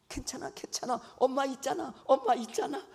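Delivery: lightly voiced soothing mother. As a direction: coo it like a mom to a scared kid.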